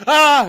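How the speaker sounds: high, loud mock shriek; mock scream. Kid and stranger scream at each other, hammed-up. Imagined mutual screaming scene.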